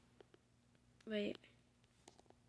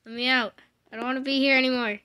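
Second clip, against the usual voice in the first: pleading loudly; 2 semitones higher, 10 dB louder, faster than usual. Real, not acted. Mock pleading when trapped.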